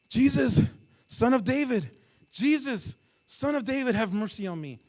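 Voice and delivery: high, full-force shouted plea; desperate yelling. Acted. Blind beggar calling to Jesus as he passes, desperate yelling. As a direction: cry out loudly for mercy. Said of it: "crying, yelling".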